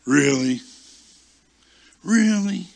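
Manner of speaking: loud, high, incredulous challenge; incredulous rebuke. Retold or imitated. Holy Spirit calling out the pouting preacher, loud incredulous rebuke. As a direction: hit it high and loud in disbelief.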